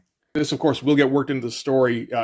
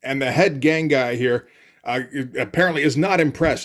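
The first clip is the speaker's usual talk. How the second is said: ordinary talk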